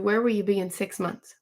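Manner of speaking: usual speaking voice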